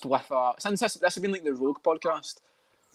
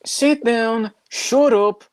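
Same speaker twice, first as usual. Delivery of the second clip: high, loud mock Irish; slow, emphatic Irish scolding; exaggerated Irish accent; Ian Gary; 7 semitones higher, 5 dB louder, much slower than usual. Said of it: "my Ian Gary type voice".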